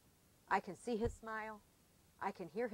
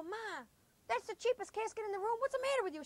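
higher, disbelieving protest rising to the end; mock outrage at mom's choice, pitched up; incredulous kids; 12 semitones higher than usual. Kids protest to mother over the cheap casket, mock disbelief. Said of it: "we go".

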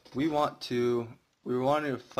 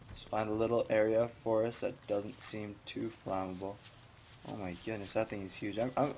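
usual speaking voice

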